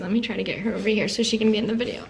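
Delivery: ordinary delivery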